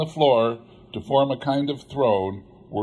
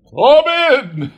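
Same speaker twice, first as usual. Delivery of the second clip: huge, much higher, much louder jolly call; booming ghost exclaiming; 14 semitones higher, 11 dB louder than usual. Acted. The jolly giant ghost greets Scrooge, booming. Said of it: "exclaimed the ghost".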